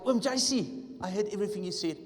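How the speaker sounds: put-on high, loud eager little girl voice; excited girl. Schoolgirl to her uncle, high excited voice. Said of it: "And she was like".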